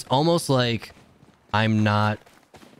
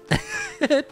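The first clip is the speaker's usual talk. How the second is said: high laugh. Real, not acted. Laughing at own accent.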